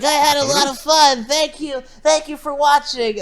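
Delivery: high-pitched mock kids' show sign-off; chirpy sign-off. Character thanks viewers at a false ending, high mock sign-off. Mimics film character signing off.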